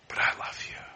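soft whispered love; tender whisper. Acted. Jesus comforting the possessed man, whispered.

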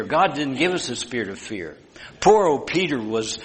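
usual speaking voice

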